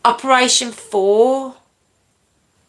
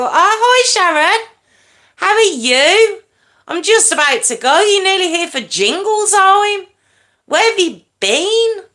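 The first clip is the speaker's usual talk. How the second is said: excited greeting; 10 semitones higher than usual. Real, not acted. Greeting a viewer on stream.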